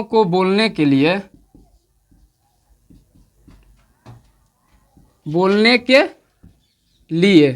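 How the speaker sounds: word by word, with long pauses while writing; slow dictation. Shown as a demonstration. Teacher dictating the definition to students, slow and halting. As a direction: dictate slowly so students can copy. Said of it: "तो लिख देते हैं definition आपका".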